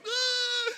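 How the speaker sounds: loud, very high open-mouthed wail; shocked yelp. Acted. Pupil caught whispering by the teacher, shocked high cry. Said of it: "And when you went".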